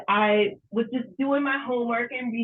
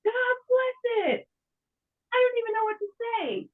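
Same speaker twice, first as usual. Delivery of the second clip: excited; 10 semitones higher than usual. Real, not acted. Reacting to winning prize.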